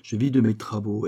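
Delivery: usual speaking voice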